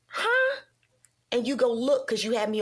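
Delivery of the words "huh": a much higher startled "Huh?"; shocked huh. Retold or imitated. Mother waking to her son's call, high shocked question. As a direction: jolt up in disbelief. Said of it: "I'm like".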